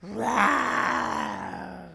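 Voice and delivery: big booming roar from the lion; loud lion roar. A narrator's reading. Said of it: "he roared very loud".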